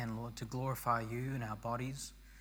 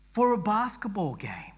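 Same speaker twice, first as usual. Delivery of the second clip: raised for emphasis; 9 semitones higher, 7 dB louder than usual. Shown as a demonstration. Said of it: "repeats the phrase for effect".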